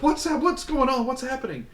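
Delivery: put-on scared girl begging while being dragged out; panicked pleading. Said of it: "she's crying and begging".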